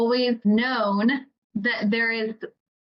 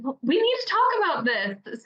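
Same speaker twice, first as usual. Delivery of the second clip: pitched-up, keen self-quote; eager reply; 7 semitones higher than usual. Retold or imitated. Author answering readers, voice raised high with eagerness. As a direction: answer like you just found your calling. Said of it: "me sitting there going".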